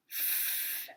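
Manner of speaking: F as a soft breathy f hiss; letter sound. Shown as a demonstration. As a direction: blow out F as a long fff. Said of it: "A little faster".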